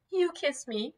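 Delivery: put-on high, soft girlish plea; softly voiced young woman; girl's voice. A narrator's reading. Girl's tearful request, voiced higher.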